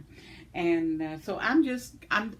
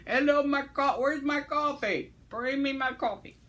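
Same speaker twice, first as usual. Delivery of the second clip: loud, higher-pitched calling out for coffee; playful mock demand; 6 semitones higher, 5 dB louder than usual. Calling out for someone to bring the empty cup's refill, mock-demanding. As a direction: holler for service in mock impatience.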